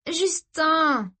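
high, cheerful answer; giddy sister. Acted. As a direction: toss it off blissfully.

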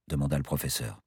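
ordinary delivery, nothing marked

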